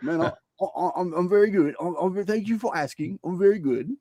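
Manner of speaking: mock posh British accent, overly polite. Host greeting his guest in a mock British accent. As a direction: put on a proper English accent for the greeting. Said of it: "I try to practice my, my English".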